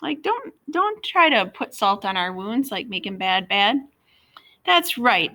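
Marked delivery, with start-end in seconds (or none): 4.6-5.3 smugly. A narrator's reading. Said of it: "Junior said smugly".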